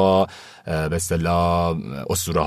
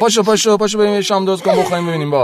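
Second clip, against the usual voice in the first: higher and louder, fed up, waving it off; husband impatiently tells wife to get up and cook dinner; exasperated husband; 10 semitones higher, 5 dB louder, faster than usual. Acted. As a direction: hurry her up, annoyed and fast. Husband brushes off wife's complaint.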